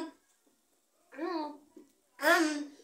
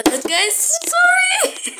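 loud, high shouted apology; shouting; 6 dB louder, slower than usual. Real, not acted. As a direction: yell sorry.

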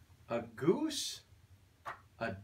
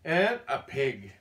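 ordinary delivery, nothing marked

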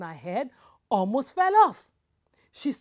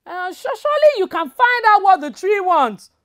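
nervous urging; 10 semitones higher, 11 dB louder than usual. Acted. Woman urges the man.

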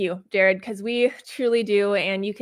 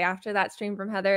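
ordinary delivery

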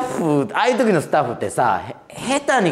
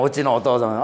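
usual speaking voice

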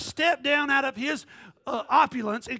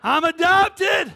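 shouted, drawn-out, joyful; slow shouted declaration; 6 semitones higher, 12 dB louder, much slower than usual. Shown as a demonstration. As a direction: stretch it out, much louder and higher.

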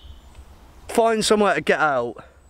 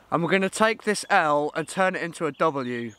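ordinary delivery

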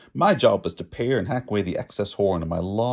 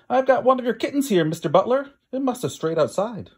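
raised, higher voice calling across the farmyard; 11 semitones higher, 4 dB louder than usual. Acted. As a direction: call out to the farmer, a touch eager. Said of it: "I called out to the farmer".

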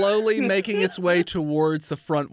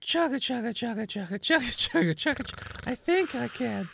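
higher chugging train voice; little engine voice; 6 semitones higher than usual. Pretend. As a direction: puff along like a little train. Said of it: "read a little bit in the voice of the character".

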